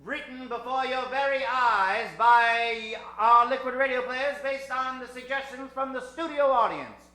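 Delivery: put-on loud old-time announcer read; radio announcer. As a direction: sell the show to the audience.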